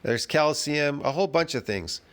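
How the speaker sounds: usual speaking voice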